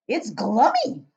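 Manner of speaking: loud, slow savouring giant; delighted cry. Acted. Giant tasting Frobscottle, loud delighted cry. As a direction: cry it out in bliss. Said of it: "he cried".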